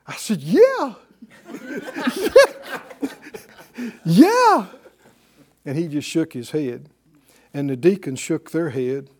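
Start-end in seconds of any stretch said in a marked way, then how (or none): 0.4-0.9 high, loud, naive 'yeah'; eager yes. Retold or imitated. Young teacher answering the pastor, eagerly and proudly. 4.1-4.7 high, loud, naive 'yeah'; eager yes. Retold or imitated. Young teacher answering the pastor, eagerly and proudly.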